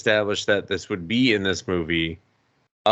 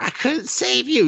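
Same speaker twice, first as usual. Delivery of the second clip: mock Snake Eyes; 14 semitones higher than usual. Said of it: "Snake Eyes is like".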